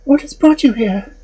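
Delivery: high, anxious maiden. Acted. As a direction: lift to a high young woman's voice. Maiden's high-voiced reply.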